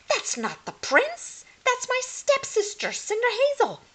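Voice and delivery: high, loud sudden objection; crying out. A narrator's reading. Hermione squints at the dirty face, high loud cry. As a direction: stop them short, surprised. Hermione unmasks Cinder Hazel.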